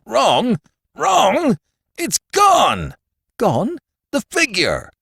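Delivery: high-pitched, incredulous shouting; frantic outcry. A narrator's reading. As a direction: cry out in panic at a shocking loss. Brewster finds his figure stolen.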